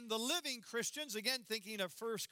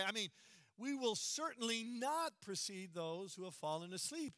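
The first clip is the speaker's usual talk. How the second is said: normal talking voice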